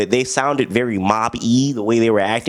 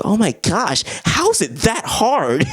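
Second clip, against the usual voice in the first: mock shocked girlfriend; 8 semitones higher, slower than usual. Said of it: "she's like".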